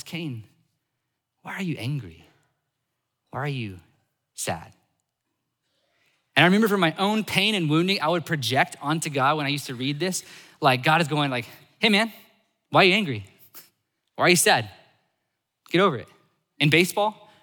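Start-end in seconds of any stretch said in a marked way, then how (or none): 0.0-4.7 soft, unhurried question with long pauses; gentle questioning. Acted. God speaking to hurt Cain, gentle questioning. 11.8-16.1 loud, higher-pitched, mock impatient challenge; brusque dismissal. God as the wounded reader imagines him, brusque dismissal.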